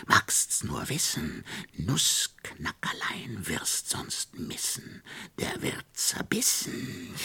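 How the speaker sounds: slow hissing whisper of a mouse king; mustache twirling villain. Acted. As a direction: hiss every word like a sinister rodent. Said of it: "zischte er".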